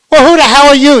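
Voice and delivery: high, loud demand; exasperated yell. Retold or imitated. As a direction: throw the question back furious. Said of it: "Guy says".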